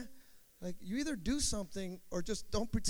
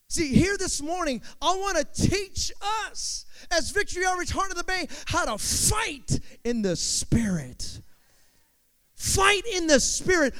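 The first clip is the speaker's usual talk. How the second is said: loud, raised-pitch sermon delivery; full-force preaching; 10 semitones higher, 11 dB louder, slower than usual. Real, not acted. Preacher urging the congregation to fight for truth, full-force preaching. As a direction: preach it out with stretched key words.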